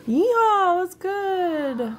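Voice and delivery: high, loud yeehaw holler; sustained high-pitched hello; whooped greeting. Real, not acted.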